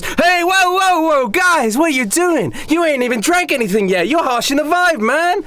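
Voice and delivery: very high, whining party-dude protest; club partier. Acted. Club dancer stops guys harshing the vibe, protesting. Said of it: "he walks over and goes".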